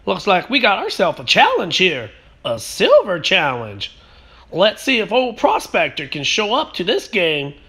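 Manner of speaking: loud, high gold-rush prospector hollering; old prospector. Acted. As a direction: holler like a grizzled old miner. Intro in old prospector character voice.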